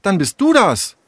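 high-pitched, accusing attack; quarrelsome. Acted. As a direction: turn on her, scolding. Tessa turns on Sarah in quarrel. (streitlustig, mit hoher Stimme)

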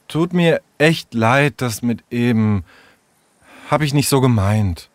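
halting, sheepish, with long pauses; hesitant apology. Acted. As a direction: apologise awkwardly, bit by bit. Sarah's hesitant apology after quarrel. (zögernde, kleinlaute Entschuldigung)

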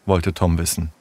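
ordinary delivery

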